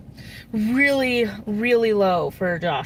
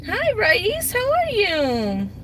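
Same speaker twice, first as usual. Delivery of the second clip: much higher, drawn-out sweet greeting; 10 semitones higher, much slower than usual. Real, not acted. Cooing hello to little Raíz, high and slow.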